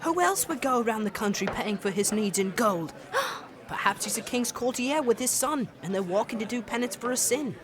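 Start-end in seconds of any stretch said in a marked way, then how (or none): 3.0-3.5 quick high breathy gasp; excited gasp. Acted. Girl gasping at the idea of a courtier. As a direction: gasp with thrilled wonder. Imagines noble visitors.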